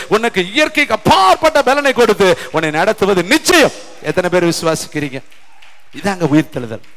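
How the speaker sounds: normal talking voice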